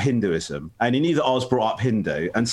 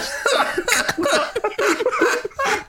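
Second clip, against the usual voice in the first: laughing hard, high up; high burst of laughter. Real, not acted. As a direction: break into helpless laughter. Laughing at the memory.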